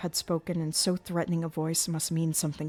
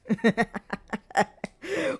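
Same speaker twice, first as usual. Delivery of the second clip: mocking monster laugh; 5 semitones higher, 3 dB louder than usual.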